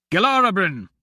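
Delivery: loud call of a name. A narrator's reading. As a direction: call across the chamber. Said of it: "Royce called".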